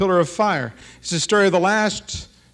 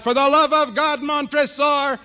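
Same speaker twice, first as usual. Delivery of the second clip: cries out; 10 semitones higher than usual. Acted. Dead man crying out as he's shoved into the furnace. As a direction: wail it as the furnace door shuts. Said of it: "He cries out".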